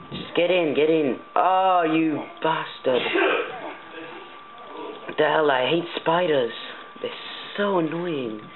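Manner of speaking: high, frustrated gamer complaint. Real, not acted. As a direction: whine at the game as it beats you. Failing to get inside house.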